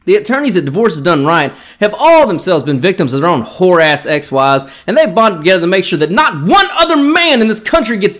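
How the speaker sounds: fired-up sponsor pitch; ad read. Acted. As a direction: sell it like a trashy attorney ad. Parody ad read continues.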